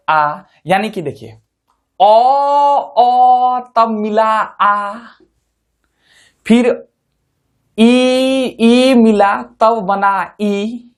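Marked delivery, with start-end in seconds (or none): 2.0-10.8 stretched vowel demo. Shown as a demonstration. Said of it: "समझ रहे हैं कि नहीं".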